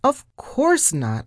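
high, drawn-out cheerful assurance; happily. A narrator's reading. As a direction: stretch it out with delight. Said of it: "I said happily".

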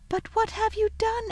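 put-on high little girl's question; Lucy, puzzled. A narrator's reading. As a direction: ask lightly in a girlish voice. Girl questions faun.

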